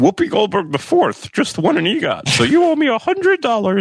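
put-on high, strained old man voice. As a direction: play a creaky old man on the phone. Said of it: "It's your old man voice".

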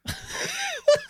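squealing high-pitched laugh; high cracking laugh. Real, not acted. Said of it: "He's so amused by this".

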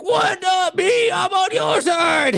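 high, slow outraged yell; mock indignant shout. McClane yelling at the FBI helicopter, high indignant shout. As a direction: protest your innocence at the top of your voice. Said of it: "John McClane is like".

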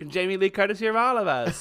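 high, loud laughing cry; meme exclamation. Acted. Quoting a meme to her co-host, high and laughing.

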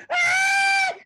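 high, loud mock scream through laughter. Storyteller mimicking the girl who hurt herself, mock scream. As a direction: squeal out a comic cry of pain. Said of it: "So she starts screaming".

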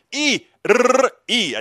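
high purring rolled R; purring latin lover. Shown as a demonstration. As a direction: purr the letters like a Latin lover. Earlier reader guess.

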